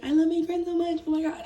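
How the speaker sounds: high, loud, gushing over friends; mock-gush about how much she loves them; hammed-up happy gush. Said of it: "I come to this camera saying".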